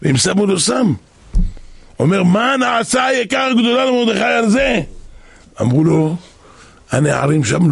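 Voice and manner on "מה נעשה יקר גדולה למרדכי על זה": high, sustained exclaimed question; demanding call. Acted. King asks servants how Mordechai was honored, demanding call. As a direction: call it out insistently, pitched up.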